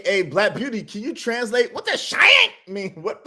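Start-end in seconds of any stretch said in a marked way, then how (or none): 1.7-2.9 high, loud disbelieving question; baffled outburst. Real, not acted. Reactor stunned by the clip, loud high disbelief. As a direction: blurt it out in total confusion.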